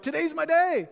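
high, loud burst of delight; excited. Acted. Preacher voicing someone about to be celebrated at church, acted excitement. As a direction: crow it like the guest of honor. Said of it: "You'd be excited".

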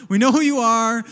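pointed mock threat, pushed loud; mock warning. Pastor rewording the sign for the thieves, mock threatening.

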